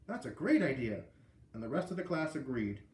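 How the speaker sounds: plain reading voice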